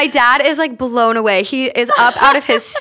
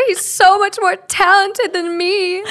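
mock whiny boyfriend; 7 semitones higher, slower than usual. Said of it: "I don't compare".

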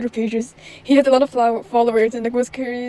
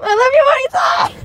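high, loud lovestruck declaration; giddy confession; 6 dB louder than usual. Acted. Confessing to his friend, giddy and loud. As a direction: blurt out your love.

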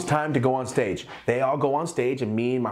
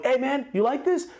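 much higher, easy buddy tone; high light voice asking a buddy's opinion; casual friendly ask. Retold or imitated. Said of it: "like me texting you and going".

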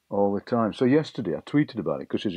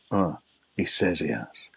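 a lower, doubting imitation of the cynical friend; 5 semitones lower than usual. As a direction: mimic her disbelief lightly. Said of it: "her mate was a bit more cynical".

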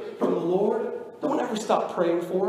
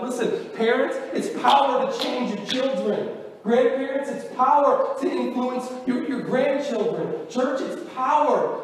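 rising preaching; 6 semitones higher than usual. Acted. Preaching builds.

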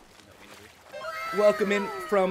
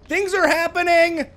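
much higher, louder gleeful cry; excited shout; 11 semitones higher, 8 dB louder than usual. Real, not acted. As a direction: shout it out like everything's exploding. Overwhelmed streamer during a raid.

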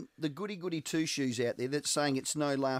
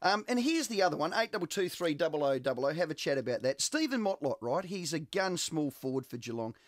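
ordinary talk, nothing marked

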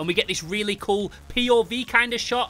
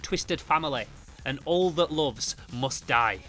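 soft, measured, grave reading; solemn comic narration; 3 semitones lower, 5 dB quieter than usual. Acted. Reading what goes on inside Jason's head, solemn narration. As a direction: read it quietly like a tragic inner monologue. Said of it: "the narration lets us know".

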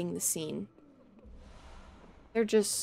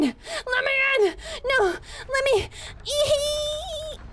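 much higher, crying squeal of begging; squealing plea; 13 semitones higher, slower than usual. Pretend. Streamer begging the game door to open, squealing. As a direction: whine like a desperate kid at a door.

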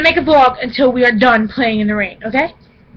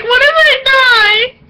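high, slow, drawn-out whiny plea; mock panic; 12 semitones higher, 4 dB louder, slower than usual. Asking mom if she'll die from rain, hammed-up panic. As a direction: overplay the fear of dying in rain. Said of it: "I was like".

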